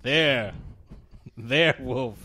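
put-on Igor voice; hammed-up movie character reply; exaggerated cartoon intonation. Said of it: "Why are you talking like that?".

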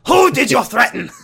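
high, loud, put-on questioning; hammed-up doctor demanding an answer; mock doctor voice. Doctor James voiced comically.

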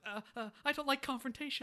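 high, stammering and meek; a timid dinosaur backing off; nervous Rex. Retold or imitated. Rex refusing to take sides, in a high, nervous stammer. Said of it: "And Rex is like".

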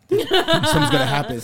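high, tense mock whisper-ish anticipation; high nervous friend waiting for a scare; imitating buddy. Buddy voiced anticipating jump scare.

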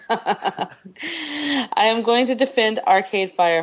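reading through laughter, slow; laughing. Real, not acted. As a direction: laugh as you read it out.

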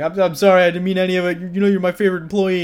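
grovel, scared for your life; blubbering apology. Retold or imitated. Boss with a gun in his face, blubbering apology. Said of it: "blubbering and apologizing".

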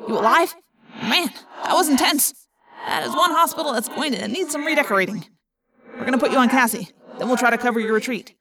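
Marco voice. A narrator's reading. Marco, joking in escape.

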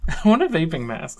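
laughing while repeating it. Real, not acted. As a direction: chuckle through the words. Repeats friend's line laughing.

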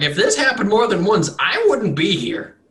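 high, loud insistence; emphatic farmer. Acted. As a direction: stress this is the only time.

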